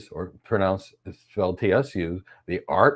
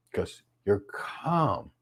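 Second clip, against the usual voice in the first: calm; 4 semitones lower, 9 dB quieter, much slower than usual. Shown as a demonstration. Speaker models calm in voice.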